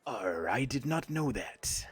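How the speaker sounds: slow mock pirate growl; lightly voiced pirate. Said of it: "Talk Like a Pirate Day".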